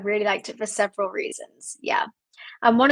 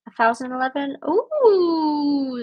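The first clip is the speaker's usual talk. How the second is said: ordinary talk, nothing marked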